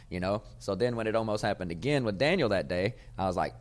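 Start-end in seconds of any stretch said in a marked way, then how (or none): none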